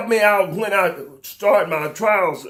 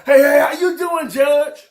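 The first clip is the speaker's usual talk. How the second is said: high, loud, hammed-up sucking-up hello; mock fawning greeting; 6 semitones higher, 5 dB louder than usual. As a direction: cry it out like a brown-noser greeting the judge. Mocking fawning greeting to judge.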